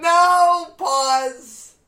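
high shouted no; loud no. Real, not acted. Wife stopping husband mid-sentence, loud. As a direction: cut him off with a loud high no.

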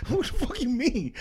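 high-pitched retold comeback; movie scene impression.

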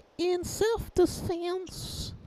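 very high, slow cartoon voice; high silly voice. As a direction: squeak it out slowly. High goofy voice bit.

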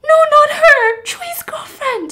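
very high crying scream. Retold or imitated. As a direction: shriek it, then drag it out. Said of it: "the person screams".

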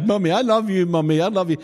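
a hint of a toddler buttering up her mum; sweet little girl. Preacher mimicking a child buttering up her mum, lightly voiced. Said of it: "I do recognise".

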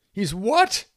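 high startled squeak. A narrator's reading. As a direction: squeak it out in shock. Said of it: "Nobby squeaked".

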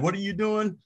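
a hammed-up suspicious ex-player at the door; imitating J R Rider. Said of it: "it's like he keeps coming outside".

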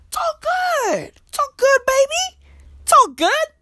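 high squeaky protest that all is fine; mock acceptance. Comedian insisting he is fine about land ownership, high strained mock acceptance.

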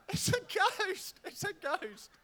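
high, hammed-up frightened squeal; comic high-pitched panic; mock terrified. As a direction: squeak it out high like scared disciples. Voices frightened disciples seeing Jesus.

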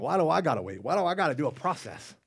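exasperated why-me whine; whiny complaint. Retold or imitated. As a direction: complain like an impatient kid. Impatient whining repeated.

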